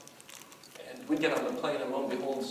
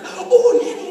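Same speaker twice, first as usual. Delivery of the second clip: high, loud delighted cry; excited kids greeting; 16 dB louder, slower than usual. Retold or imitated. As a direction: squeal a child's greeting to nanny.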